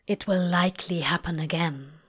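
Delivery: low, understated statement of fact; matter-of-fact. A narrator's reading. Crowley refusing to play along with the lies, matter-of-fact. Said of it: "he says matter-of-factly".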